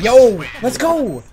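loud, high, drawn-out cheer; hyped celebration. Real, not acted. Celebrating a grenade kill.

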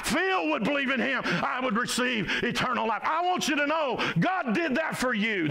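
high, driving full-force preaching. Real, not acted. Preacher urging the congregation to personalize Scripture, preaching at full force. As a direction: preach it urgently, pitch held high.

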